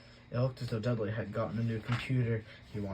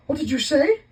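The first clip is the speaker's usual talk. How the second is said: much higher, loud sharp snap; Aunt Petunia snapping; 14 dB louder than usual. A narrator's reading. Aunt snapping at the door, high and loud. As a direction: catch him out, suspicious. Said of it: "his aunt snapped".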